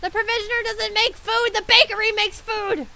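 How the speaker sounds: high, loud exasperated rant; exasperated shout. Real, not acted. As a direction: yell the obvious at them. Exasperated explanation.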